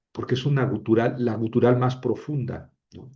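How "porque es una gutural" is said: ordinary delivery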